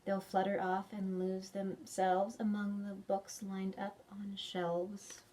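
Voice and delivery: usual speaking voice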